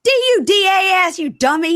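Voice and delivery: high, loud mock yelling; retold exasperated shout. Woman spelling bar's name for him, shouted in mock exasperation. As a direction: holler the letters as an insult.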